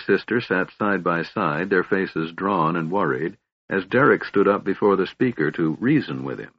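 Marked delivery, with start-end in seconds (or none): none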